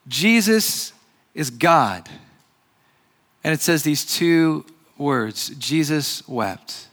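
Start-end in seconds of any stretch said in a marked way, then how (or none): 5.6-6.9 缓慢轻声读经; slow quiet reading. Acted. Reading the short verse.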